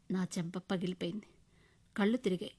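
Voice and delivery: ordinary delivery, nothing marked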